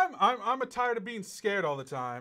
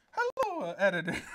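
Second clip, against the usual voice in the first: put-on Italian accent. Host restarting the letter with an Italian hello. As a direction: sing out the hello Italian-style. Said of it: "Italian accent".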